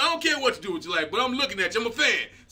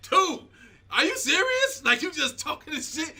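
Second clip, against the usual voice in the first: like you can't believe what you just heard; stunned "are you serious" with a laugh; disbelief; 6 semitones higher than usual. Retold or imitated. Reacting to the story, "I'm like.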